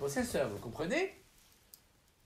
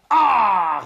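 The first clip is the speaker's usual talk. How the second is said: loud monstrous roar; 5 semitones higher, 15 dB louder than usual. Acted. Monster roars at the little man's question, loud roar. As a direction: let out a huge open Ah roar. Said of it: "rugit le dix-neuvième siècle".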